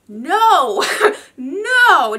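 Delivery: loud, laughing mock horror; hammed-up loud refusal; emphatic rejection. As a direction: cry out a comic, drawn-out no. Acting out failed lashes on her.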